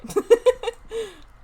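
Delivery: loud high burst of laughter. Real, not acted. Streamer laughs about her plans, loud high laugh. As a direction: let a big laugh burst out.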